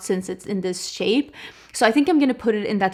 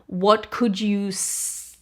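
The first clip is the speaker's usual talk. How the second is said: ordinary delivery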